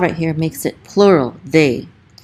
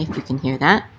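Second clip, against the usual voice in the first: normal talking voice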